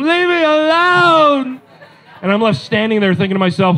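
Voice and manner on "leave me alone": put-on high, drawn-out wail of a sulking boy; boy yelling from his bedroom, hammed up; kid yell. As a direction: yell it slowly like a slammed-door tantrum. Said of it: "he yells from inside his room".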